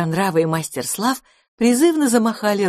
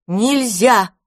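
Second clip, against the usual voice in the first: chorus shout; 5 semitones higher, 5 dB louder than usual. A narrator's reading. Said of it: "хором ответили".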